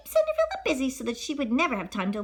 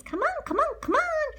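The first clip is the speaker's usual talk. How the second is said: high mouse voice. Acted. Mouse urging.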